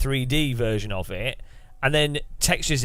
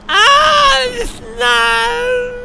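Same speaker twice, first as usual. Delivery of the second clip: very high, loud and slow squeal; mock squealing wife; 10 dB louder, much slower than usual. Mocking the wife excited by snow, high and drawn out. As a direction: drag it out in a shrill squeal. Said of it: "So you had to mute it".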